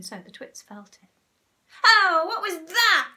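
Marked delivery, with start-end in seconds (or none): 1.8-3.1 high, loud startled outcry; shrill-pitched alarmed question; startled cry. A narrator's reading. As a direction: cry out loudly as a startled Mrs Twit. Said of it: "cried Missus Twit".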